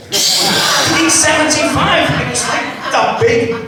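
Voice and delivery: very high, slow disbelieving squeal; outraged. Retold or imitated. Hearing the bread price in Asda, high slow outrage. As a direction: stretch out the price in shock.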